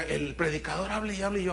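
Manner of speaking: ordinary talk, nothing marked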